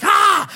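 short high yelp of fright; a sudden high scared cry; frightened yelp. Retold or imitated. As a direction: yelp like the pit is right behind you. Terrified among the snakes.

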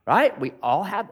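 ordinary talk

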